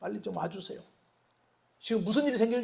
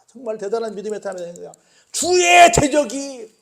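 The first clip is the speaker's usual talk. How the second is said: fervent outcry; 3 semitones higher, 10 dB louder than usual. Real, not acted. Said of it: "crying shout while preaching".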